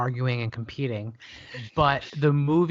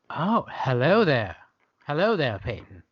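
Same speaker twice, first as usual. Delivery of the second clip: playful character greeting with a swooping fall; put-on greeting voice. Host trying out a voice on the co-host, playful put-on voice. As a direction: put on a voice, stretching the hello. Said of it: "all these voices and accents".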